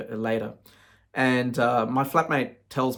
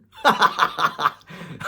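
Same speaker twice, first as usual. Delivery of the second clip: laugh; 5 semitones higher, 5 dB louder than usual. Real, not acted. Laughing at brother's dream.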